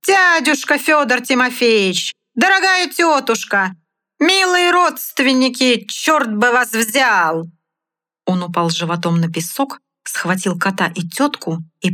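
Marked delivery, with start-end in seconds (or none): 0.1-7.5 clown shouting. Acted. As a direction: play it big for the audience. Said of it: "закричал хозяин".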